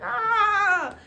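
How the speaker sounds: high piercing mock scream; sudden high retold scream. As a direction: shriek as if grabbed from below. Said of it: "and she's screaming".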